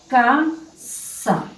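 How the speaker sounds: "casa" split slowly as ca... sa; syllable by syllable. Shown as a demonstration. Teacher introduces a two-syllable word, syllable by syllable. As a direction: sound out "casa" in two separate beats. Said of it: "tienen dos sílabas".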